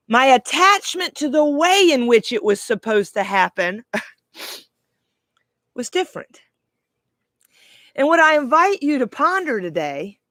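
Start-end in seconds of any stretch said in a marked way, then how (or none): 3.9-6.4 laughing whisper. Real, not acted. Laughing aside after attachment remark.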